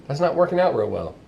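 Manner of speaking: ordinary talk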